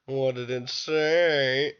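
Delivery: slow, drawn-out snide boss voice; a lightly voiced smug boss, taking his time; Peter's voice. Boss asking about graffiti, slow and snide. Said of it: "he says, in Peter's voice".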